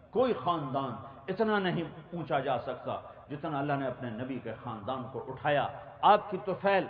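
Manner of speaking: ordinary delivery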